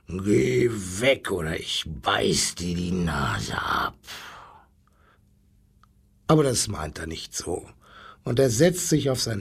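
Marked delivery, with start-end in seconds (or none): 0.1-4.0 low, growled threat; knurrender Löwe. A narrator's reading. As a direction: growl it low like a grumpy lion. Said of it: "knurrt der zottige Löwe". (tief knurrend, wie ein mürrischer Löwe)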